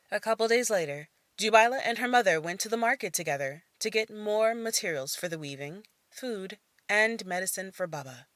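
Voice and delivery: ordinary delivery, nothing marked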